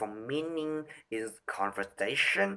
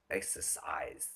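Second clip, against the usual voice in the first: whispered; 7 semitones lower, 11 dB quieter than usual. Shown as a demonstration. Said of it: "to improve the timbre".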